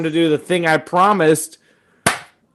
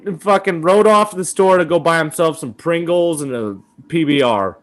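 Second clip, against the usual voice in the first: normal talking voice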